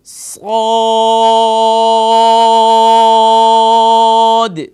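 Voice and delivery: صاد drawn out very long, full-mouthed and loud; tajweed practice, long madd. Shown as a demonstration. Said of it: "صاد practice کرتے ہیں".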